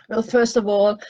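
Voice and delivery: usual speaking voice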